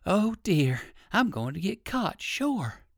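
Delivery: mild storybook fright; frightened cry. A narrator's reading. Rabbit trapped between dog and auto, mildly frightened cry. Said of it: "cried Uncle Wiggily".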